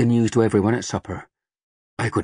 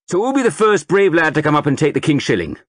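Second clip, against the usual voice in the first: loud, high recruiting sergeant rousing a crowd; sergeant major; 6 semitones higher, 4 dB louder than usual. A narrator's reading. Sergeant major rousing the market crowd, booming. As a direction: bellow it to the whole square like a drill sergeant.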